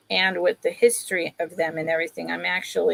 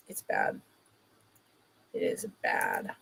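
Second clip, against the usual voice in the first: breathy whisper about her bad back; whispered admission that her back is bad; 11 dB quieter than usual. Real, not acted. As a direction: drop to a bare whisper.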